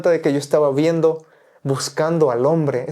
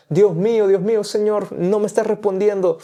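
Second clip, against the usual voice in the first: whiny plea to God; 6 semitones higher than usual. Retold or imitated. Quejándose a Dios en oración.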